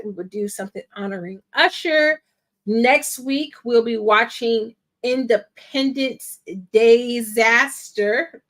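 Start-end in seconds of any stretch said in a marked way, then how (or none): 5.0-8.4 "Independence Disaster" drawn out slowly, syllable by syllable; emphasized title pun. Real, not acted. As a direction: stretch "Disaster" slowly as "DAY-zaster", so the pun lands. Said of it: "Not Independence Day".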